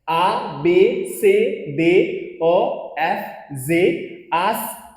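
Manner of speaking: आ बे से दे drawn out very slowly, each letter separate; French alphabet recital. Shown as a demonstration. Teacher slowly recites the French alphabet to the class. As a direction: chant the letters slowly and evenly. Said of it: "तो हो क्या रहा है हमारे साथ".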